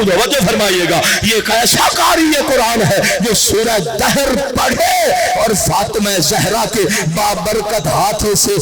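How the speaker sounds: full-throated majlis chant with Quranic lines; impassioned preaching. Acted. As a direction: build the sermon to a sung climax. Preaching peak with chanted verses.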